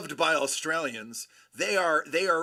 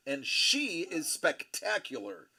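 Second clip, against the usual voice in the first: normal talking voice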